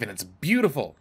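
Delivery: ordinary talk, nothing marked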